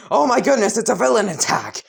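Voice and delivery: high, quick-rising mock alarm; mock panicked scream. Classmates mistake the teacher for a villain, mock panic. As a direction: imitate a panicking crowd without a full scream. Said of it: "everyone's screaming".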